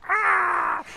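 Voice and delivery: long high drawn-out ah; hammed-up exasperated wail; stressed cry. The couple mock-wail right before teaching a class. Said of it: "we're like".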